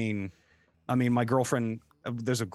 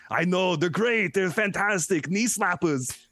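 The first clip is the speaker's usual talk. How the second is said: slow, higher mock Arnold accent; hammed-up Arnold accent, drawn out; Arnold impression; 6 semitones higher, slower than usual. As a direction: put on an Arnold accent, pleased with yourself. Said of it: "dad jokes in Arnold's voice".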